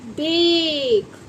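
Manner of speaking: बेक stretched very slowly; long drawn vowel. Shown as a demonstration. Teacher sounding out बेक for children. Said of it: "बी ए के ई".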